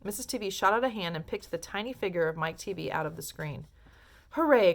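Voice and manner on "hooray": higher, much louder hooray; joyful cheer. A narrator's reading. Wonka cheering Mike's return, higher and much louder. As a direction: cheer with relief. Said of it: "cried Mister Wonka".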